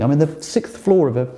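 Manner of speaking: ordinary delivery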